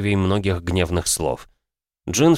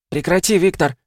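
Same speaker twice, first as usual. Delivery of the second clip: forced out with effort; strained; 11 semitones higher, 4 dB louder than usual. Acted. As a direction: struggle to get the words out. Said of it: "с трудом выговорила Диана".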